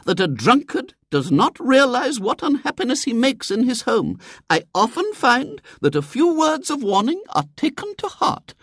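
very high, moralizing lady's voice; preachy lady. A narrator's reading. Lady lectures William on drunkards, preachy and high. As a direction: lecture like a temperance crusader.

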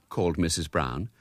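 plain reading voice

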